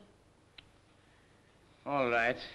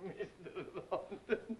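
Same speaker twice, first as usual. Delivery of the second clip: laughing softly; 2 semitones higher, 9 dB quieter, slower than usual. Acted. Still laughing at the name.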